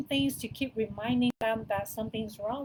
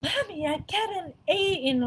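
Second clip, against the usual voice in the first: excited daughter; 8 semitones higher, 7 dB louder than usual. Retold or imitated. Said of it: "She's like".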